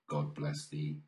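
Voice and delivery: plain reading voice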